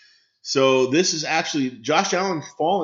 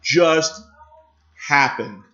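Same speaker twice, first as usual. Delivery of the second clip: loud, drawn-out, high-pitched cry of disbelief; real shock, stretching every word out; can't believe what just happened, louder and higher; stunned disbelief; 4 semitones higher, 4 dB louder, much slower than usual. Real, not acted. Stunned reaction to a shock draft pick.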